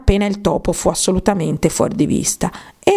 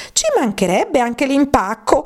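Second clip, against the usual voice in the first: shrieked; 7 semitones higher than usual. A narrator's reading. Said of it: "strillò il topo".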